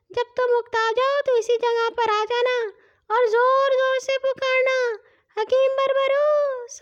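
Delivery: faraway high-pitched magical voice; voice from afar. A narrator's reading. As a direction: call out from far away, stretching the words. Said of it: "آواز کہیں دور سے سنائی دی".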